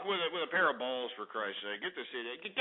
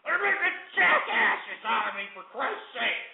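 outraged complaint. Real, not acted. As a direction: cry out at being made a fool of. Host upset at being mocked.

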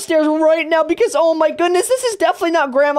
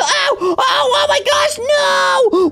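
pained cry; 8 semitones higher than usual. Pretend. Caught by the chasing grandma.

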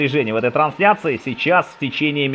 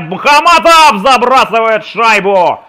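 high, loud, stretched goal shout; goal call; 8 semitones higher, 8 dB louder, slower than usual. Real, not acted. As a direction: roar the scorer's name as he scores. Live goal call in hockey.

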